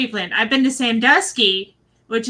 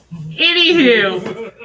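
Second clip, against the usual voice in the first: amused segue; 4 dB louder, much slower than usual. Real, not acted. Laughing, moving on after husband's antics.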